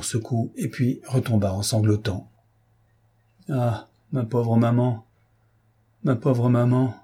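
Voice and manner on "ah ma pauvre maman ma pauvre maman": slow, broken, tearful stammer; stammering sobs. A narrator's reading. Condemned boy crying for his mother, slow tearful stammer. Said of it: "bégaya-t-il".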